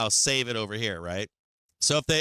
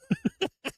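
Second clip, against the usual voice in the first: a squeaky high giggle; high squeaky laugh. Real, not acted. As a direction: laugh sharply, amused. Laugh alone.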